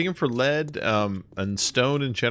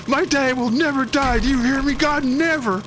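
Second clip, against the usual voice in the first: very high, loud, strained mock yell; hammed-up cry at God; 4 dB louder than usual. Mister Noblet pointing at God, high loud mock yell. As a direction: bellow it up at God, over the top. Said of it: "He goes".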